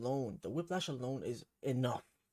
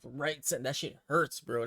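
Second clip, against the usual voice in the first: ordinary talk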